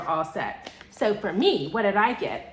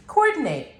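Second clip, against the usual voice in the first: movie quote; 5 semitones higher, 5 dB louder than usual. Retold or imitated. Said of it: "where it's like, coordinate?".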